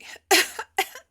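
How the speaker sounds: sharp leftover cough; lingering cough. Real, not acted. Still coughing after the gnat.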